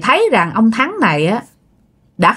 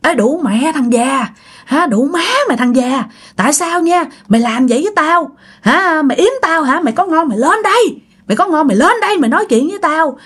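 cursing; 7 semitones higher than usual. Acted. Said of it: "Ông Thắng đứng ở trên chửi xuống".

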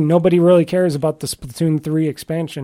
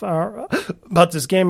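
hiccuping mid-sentence; hiccups. Real, not acted. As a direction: catch a hiccup between words. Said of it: "I got the hiccups".

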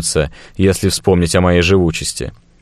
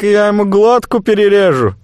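threatening merchant; 13 semitones higher than usual. Acted. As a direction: squeal it out, cornered and nervous. Fat merchant threatens hostage.

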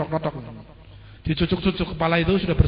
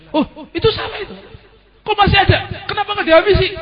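Yazid kaget marah; 12 semitones higher, 4 dB louder than usual. Acted. Yazid melihat Ali masih hidup.

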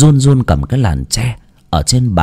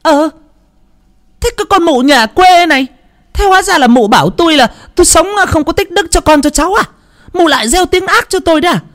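roaring in rage. Acted. Mother-in-law roaring at her in-law, furious and high. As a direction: explode at the in-law. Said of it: "trợn mắt gầm lên".

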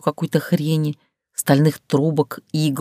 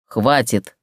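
stern man; 4 semitones lower than usual. Acted. Captor stops her struggle.

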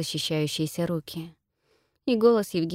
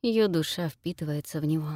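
ordinary delivery

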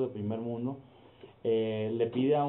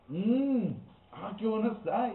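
higher, lightly hammed-up delight at the taste; mock delight; 9 semitones higher, slower than usual. Lady tourist tastes her first taco al pastor, mock delight. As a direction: savour it in a playful put-on voice.

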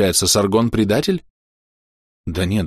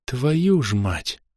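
very slow, hushed curse; drawn-out, dumbfounded swearing; stunned oath; 5 semitones higher, 3 dB quieter, much slower than usual. A narrator's reading. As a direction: let the oath slip out in amazement. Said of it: "вырвалось у выпучившего глаза Вирхима".

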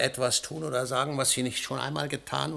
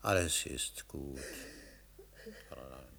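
tender comfort; 10 semitones lower, 9 dB quieter than usual. Acted. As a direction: soothe her gently. Husband comforts weeping wife.

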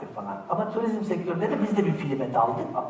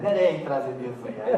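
normal talking voice

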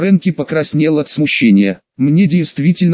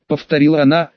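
ordinary delivery

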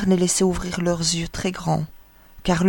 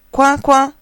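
loud, higher quacked order; commanding quack; 7 semitones higher, 6 dB louder than usual. A narrator's reading. Mother duck ordering her ducklings into the water, loud commanding quack. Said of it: "commanda-t-elle".

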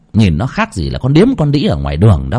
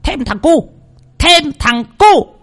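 much higher, squawky parrot voice; squawking parrot. A narrator's reading. Parrot outside squawks at the couple, shrill high squawk. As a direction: screech it like a parrot. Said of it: "con vẹt nó lại quang quác, kêu lên".

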